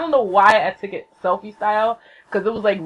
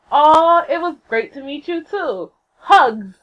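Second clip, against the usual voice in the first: high, loud opening coo; imitated fond celebrity message; affectionate; 8 semitones higher, 6 dB louder, slower than usual. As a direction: gush it sweetly, punching the last word. Voices idol's affectionate tweet.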